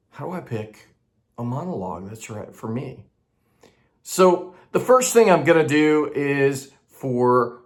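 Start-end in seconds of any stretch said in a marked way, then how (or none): 0.1-3.1 softly voiced actor's question; quietly posed question. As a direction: ask it softly, as a wondering actor would. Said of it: "has asked this very fundamental question".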